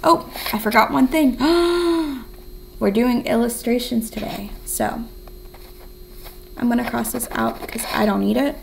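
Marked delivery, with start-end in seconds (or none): 1.4-2.7 big, high gasp of sudden remembering; excited gasp. Pretend. Teacher remembers illustrations for the class, excited gasp. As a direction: gasp as if you just remembered the fun part.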